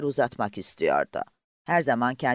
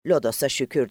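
usual speaking voice

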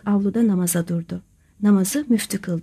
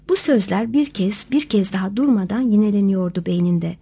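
usual speaking voice

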